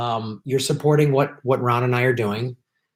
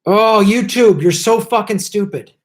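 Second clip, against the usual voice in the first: exasperated yell, higher and louder; yelling at YouTube; 7 semitones higher, 5 dB louder than usual. Real, not acted. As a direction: blow up at the screen. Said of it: "now I'm yelling at YouTube".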